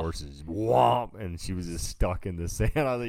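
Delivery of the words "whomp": loud, higher whomp like a heavy thud; mock thud noise, loud and high; whomp sound effect. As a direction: slam out a cartoon impact noise. Imitating her landing in sand.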